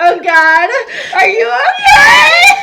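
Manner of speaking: high laughing-crying wail, slow and loud; panicked laughing cry. Retold or imitated. Mock-panicked question to vomiting patient.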